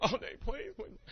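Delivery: high, whiny mock child plea; whiny little daughter. Daughter pleading with dad over a story, whiny. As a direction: imitate a daughter begging off. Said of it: "she immediately broke".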